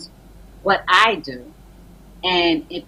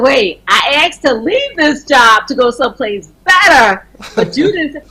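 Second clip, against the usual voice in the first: loud, raised retelling of her objection; blurting out the protest, louder and higher; excited protest; 5 semitones higher, 9 dB louder than usual. Retold or imitated. Retold argument with pastor.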